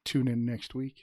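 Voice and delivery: slow announcer sign-off voice; radio sign-off. Pretend. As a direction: close the show like a radio host. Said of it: "I always wanted to say".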